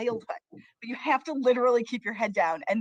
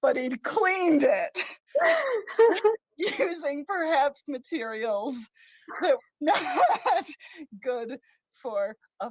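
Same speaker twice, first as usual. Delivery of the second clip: higher, slowed down by giggles; laughing; 3 semitones higher, 3 dB louder, slower than usual. Real, not acted. Host reveals how the goldfish tank was cleaned, laughing. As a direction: break into laughter between the words. Laughing at her own punchline.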